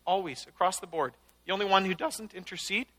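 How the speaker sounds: ordinary delivery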